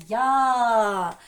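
Я-ЗИК loud, in two stretched syllables; syllable-by-syllable demo. Shown as a demonstration. Teacher leads everyone together, Я-ЗИК in long syllables. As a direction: chant Я, then ЗИК, drawn out. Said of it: "давайте всі разом швиденько скажемо".

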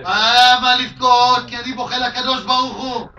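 loud, high burst of explanation; emphatic exclamation. Retold or imitated. Grieving father explaining to the mourners, loud and emphatic. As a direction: exclaim it like a revelation. Father answers why he stopped crying.

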